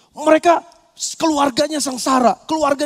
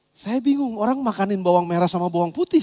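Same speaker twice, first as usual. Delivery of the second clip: normal talking voice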